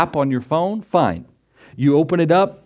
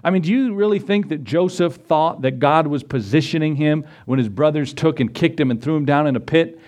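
usual speaking voice

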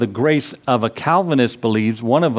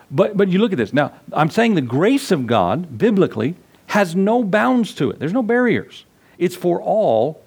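preaching at full force; 5 semitones higher than usual. Acted. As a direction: ring out each phrase from the pulpit. Preacher climaxes on grace's reach.

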